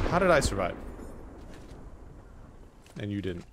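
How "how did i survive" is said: surprised. Real, not acted. Shocked at surviving.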